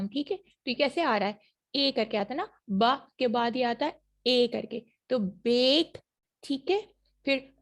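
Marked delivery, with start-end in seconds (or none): 5.2-6.0 बेक said a little louder, ay vowel stressed; demo word. Shown as a demonstration. Teacher modeling words for students to repeat, clear ay. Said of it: "मेरे साथ साथ बोलिए".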